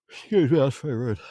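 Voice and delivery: mock offended. Mock offended at fleeing bird.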